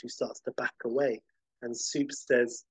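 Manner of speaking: ordinary talk, nothing marked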